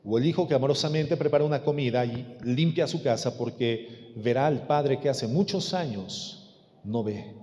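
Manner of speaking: low, soft pulpit voice; hushed preaching. Real, not acted. Preacher tells congregation of loving obedience, hushed preaching. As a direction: let it sink in quietly.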